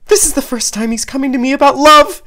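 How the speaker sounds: high, loud frantic mother; panicked. Acted. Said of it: "Panic immediately sets in for Nanako".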